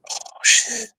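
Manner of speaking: breathless whisper of dread; injured man realizing his legs are numb, whispering; whispered oh shit. Retold or imitated. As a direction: let it slip out under the breath, stunned. Realizing he can't feel legs.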